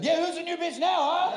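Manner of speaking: high, cocky victory crow; triumphant gloat. Retold or imitated. Runner gloating to himself at the finish, triumphant crow. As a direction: crow it like you just won.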